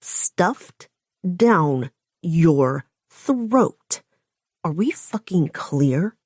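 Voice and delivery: very slow, each word crisply over-enunciated; slow deliberate over-enunciated threat. A narrator's reading. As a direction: bite off every word slowly. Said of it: "I over-enunciate each word".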